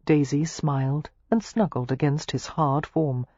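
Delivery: plain reading voice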